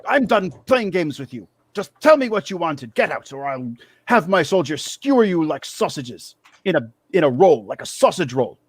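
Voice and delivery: high-pitched rant easing down by the end; exasperated threat. Acted. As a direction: snap at the intruders, high and fed up. GM voices angry man threatening intruders.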